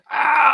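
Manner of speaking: high, loud cry of "ow"; mock yelp. Game master voicing a hidden creature, high mock yelp. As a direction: yelp as if grabbed.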